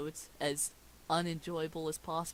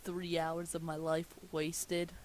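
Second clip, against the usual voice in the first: ordinary delivery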